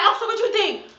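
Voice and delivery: a hint of a hopeful client; mock client. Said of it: "They be like".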